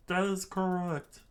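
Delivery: high reply; subordinate voice. Acted. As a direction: answer in a high small voice. Answering officer voiced high.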